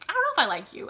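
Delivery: high, loud, dubious protest; doubtful dislike. Retold or imitated. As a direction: voice mock suspicion of someone. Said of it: "I didn't really like Tom".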